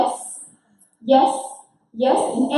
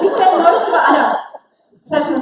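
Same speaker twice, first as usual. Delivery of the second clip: laughing the words out, higher and louder; 6 semitones higher, 8 dB louder than usual. Real, not acted. Host laughing at the group's reaction. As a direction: talk through a real laugh.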